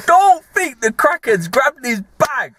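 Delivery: building it up, wide-eyed; excited. Real, not acted. Telling his mate the mad bit, excited. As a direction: pitch jumps up, slowed for effect. Excited retelling of crackhead grabbing bag.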